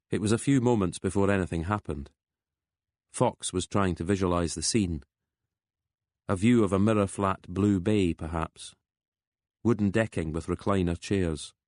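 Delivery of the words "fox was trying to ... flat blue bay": usual speaking voice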